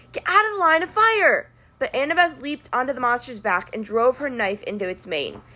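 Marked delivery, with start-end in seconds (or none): 0.1-1.5 higher urgent command; urgent order. A narrator's reading. Zoe warning Annabeth off, urgent command. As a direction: bark the warning. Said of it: "the girl with the circlet said".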